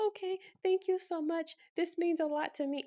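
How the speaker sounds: high, grateful, gushing thank-you. Retold or imitated. Thanking the office lady who saved her from the penalty, gushing. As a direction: beam your relief at her.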